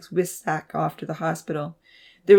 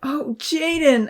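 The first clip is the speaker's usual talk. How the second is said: high, drawn-out wail; slow, high-pitched cry of relief and upset; 10 semitones higher, 4 dB louder, slower than usual. A narrator's reading. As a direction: wail his name in distress. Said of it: "Abby wailed".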